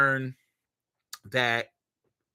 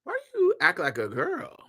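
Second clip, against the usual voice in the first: put-on curious kid voice; 13 semitones higher than usual. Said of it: "He's like".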